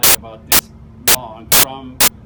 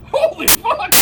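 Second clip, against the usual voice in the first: much higher, squeaky mock yelp of shock; shocked exclamation; slower than usual. Intruder sees the gun, squeals in shock. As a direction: blurt it like a panicked intruder. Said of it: "He's like".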